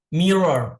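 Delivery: mirror with a falling close, both r sounds clear; model pronunciation. Shown as a demonstration. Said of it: "kaca itu dalam bahasa Inggris mirror".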